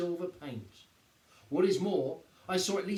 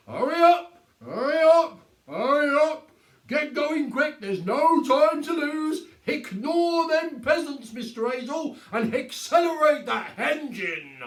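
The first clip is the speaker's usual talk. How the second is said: much higher and louder barked command; commanding policeman; 11 semitones higher, 12 dB louder than usual. A narrator's reading. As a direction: order him off like a copper. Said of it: "his most commanding policeman's voice".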